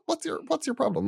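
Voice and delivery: mock indignation. Irish speaker in London reacting to a jibe, indignant. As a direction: sound baffled and put out.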